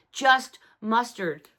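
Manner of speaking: normal talking voice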